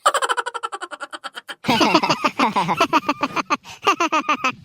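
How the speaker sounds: helpless rolling laugh; long burst of laughter. Acted. Maid amused by the child's mistake, laughing. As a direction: burst out laughing and keep going. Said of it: "ಗುಬ್ಬಲಿ aunty ನಗ್ತಾ ಇದಾರೆ".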